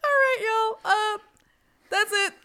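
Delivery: loud, high laughing goodbye; laughing sign-off. Real, not acted. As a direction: wrap up the show laughing.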